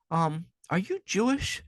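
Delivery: high, slow hesitant ask; drawn-out polite query; mock-timid question. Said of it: "and he's like".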